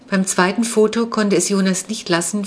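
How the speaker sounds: ordinary delivery, nothing marked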